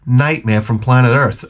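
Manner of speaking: ordinary talk, nothing marked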